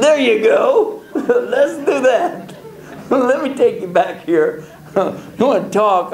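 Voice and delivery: high, slow, laughing through each line; cracking up while answering. Real, not acted. Laughing at the driver's question.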